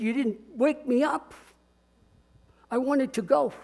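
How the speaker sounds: a hint of a sleepy child's complaint; little granddaughter. Pastor imitating his granddaughter complaining.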